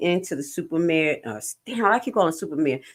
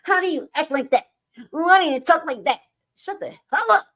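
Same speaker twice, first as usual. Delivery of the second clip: mock critic; 10 semitones higher, 5 dB louder than usual. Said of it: "Talking about".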